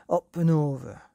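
loud eager cry. A narrator's reading. Billy cries out to his horse at the jump. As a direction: urge the horse over the wall. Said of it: "cried Billy".

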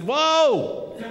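a sudden, high, startled "Whoa!". Retold or imitated. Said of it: "I did".

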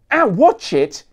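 sharp pained yelp, loud and high; cry out. Acted. Rock star hit by a lens, crying out loud. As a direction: yelp as the lens hits his face. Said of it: "he cried".

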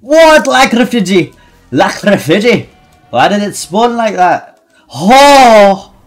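high, loud disbelief; amazed shouting. Real, not acted. Gamer astonished by an item spawn, high loud shouting.

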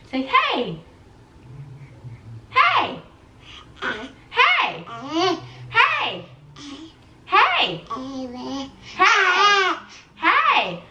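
high, sing-song calls of hey. Real, not acted. Coaxing baby to say hey.

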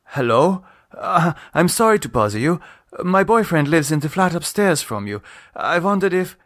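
put-on young woman asking politely over the intercom; Lisa, polite. A narrator's reading. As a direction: apologise nicely into the intercom.

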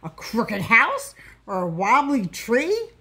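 mocking, put-on jeering voice; derisive snort. A narrator's reading. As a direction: ridicule the painter's pictures. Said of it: "they snorted".

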